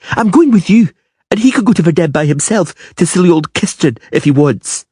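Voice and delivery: taunting the Witcher; defiant girl. A narrator's reading. Girl sides with Dryad.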